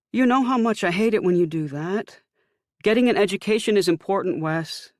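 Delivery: high, loud scolding mother. A narrator's reading. Mother scolding her son about skipping class. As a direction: lecture your son, voiced high.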